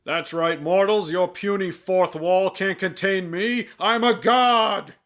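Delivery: booming god voice, slowing down; mock almighty god. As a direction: proclaim like a vain forest god. Reviewer voices Ak as deity.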